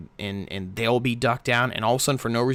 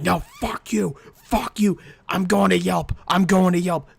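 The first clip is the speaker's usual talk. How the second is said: shouting in hammed-up outrage, pitched higher and louder; mock furious yell; 8 semitones higher, 5 dB louder than usual. Angry customer yells at the waiter over onions, hammed-up shouting. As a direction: play the customer who flips out and storms off to Yelp.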